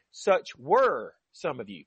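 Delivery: "were" held long and slow; slow phrase, "were" carrying the weight; stress on "were". Real, not acted. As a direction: draw out "were" to make the point. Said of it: "I'm emphasizing the word were".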